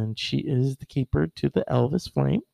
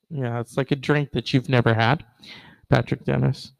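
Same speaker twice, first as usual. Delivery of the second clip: ordinary talk, nothing marked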